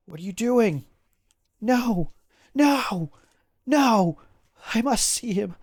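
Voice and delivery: high, loud, frantic cries; distraught screaming. Acted. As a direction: scream it in panicked grief. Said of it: "she starts screaming".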